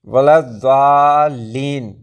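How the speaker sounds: वलद्दालीन very slow, dad halfway between d and z; Arabic dad sound. Shown as a demonstration. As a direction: stretch the long vowels of वलद्दालीन, heavy dad. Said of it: "ये आवाज़ ज़ाद की होती है".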